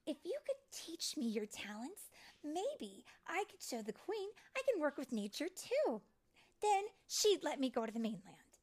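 high-pitched hopeful fairy; eager persuasion. Acted. Tinker Bell asking friends to teach her, eager.

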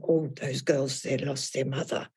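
low, soft mock-pitying murmur; saccharine pity. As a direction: imitate people pitying in a hushed, low voice. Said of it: "people's kind of saccharine, pitying looks".

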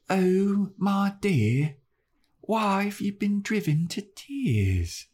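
high, slow, dreamy Drusilla voice; Drusilla impression. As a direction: croon it like a mad vampire lady. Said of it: "my Drusilla impression".

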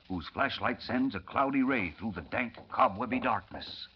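low, quiet scene narration; hushed story narration. A narrator's reading. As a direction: draw listeners into the dark.

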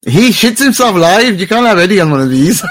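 high-pitched, laughing jibe; laughing tease. Real, not acted. Co-host teasing about Eddie on live shows, laughing. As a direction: tease through rising laughter.

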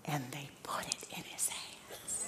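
slow, whispered storytelling climax; hushed awe. Real, not acted. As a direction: whisper it slowly, letting the moment hang. Hushed dramatic moment in sermon.

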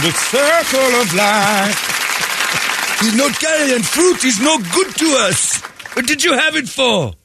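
mocking a tribe judging a baby that cannot carry fruit, high solemn put-on voice. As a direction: proclaim like a pompous Lion King elder.

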